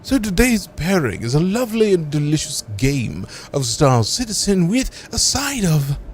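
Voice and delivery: slow, savoring fine-dining presentation; hammed-up sommelier. Streamer serving up the game as today's pairing, hammed-up waiter. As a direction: present it like a waiter announcing the special, stretching the words.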